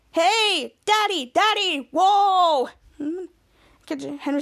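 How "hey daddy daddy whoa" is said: slow, drawn-out, higher showman delivery; demonstrating a catchphrase. Acted. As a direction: stretch each word out big, sing-song. Schwaz demonstrates the line to say.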